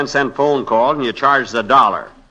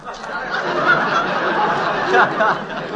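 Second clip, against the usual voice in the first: high breathy laugh, much higher; smug airy laugh at the judge; cocky laugh. Acted. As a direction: laugh it off, pleased with himself. Mocking the judge.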